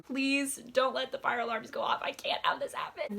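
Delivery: hammed-up desperate plea, voice rising; strained, high pleading; retold pleading. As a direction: beg in mock panic. Retold desperate prayer to the Lord.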